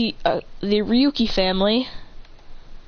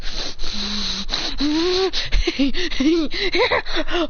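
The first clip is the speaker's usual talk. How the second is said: menacing laugh; 7 semitones higher than usual. Acted. Character laughs before threat.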